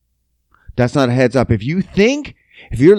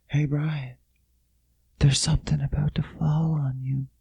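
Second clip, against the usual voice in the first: breathy whisper across a room, much softer; a barely-there whisper of a warning; 9 dB quieter than usual. Retold or imitated. Friend whispering a warning across the room, hushed whisper. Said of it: "and you whispered from across the room".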